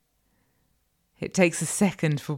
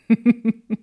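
high, loud burst of giggles; taunting laugh; 5 semitones higher, 9 dB louder than usual. Acted. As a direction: laugh in quick bursts at their nerves. Mistress laughs at the listener.

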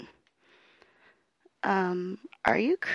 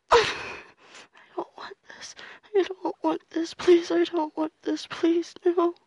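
rising desperate plea; 4 semitones higher than usual. Acted. Plea building from whisper.

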